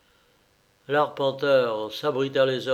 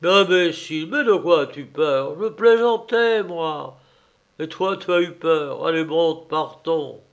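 louder, half-joking scold; mock scolding; 3 semitones higher, 6 dB louder than usual. Surveyor to the coachman, louder blustering scold. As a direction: brush off the fear with bluster.

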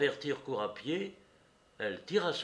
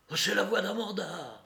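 higher-pitched cry of recognition; startled exclamation; 8 semitones higher, 3 dB louder than usual. A narrator's reading. Courrapier recognizes the woman's voice, exclaiming. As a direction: blurt it out in astonishment. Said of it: "car il s'écria".

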